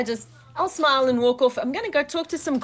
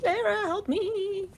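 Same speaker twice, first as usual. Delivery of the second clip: high, slow pleading prayer to Lara; desperate little prayer, drawn out; quick prayer; 9 semitones higher, 4 dB quieter, slower than usual. Pretend. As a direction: beg the goddess for help. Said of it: "I'm gonna pray to my God".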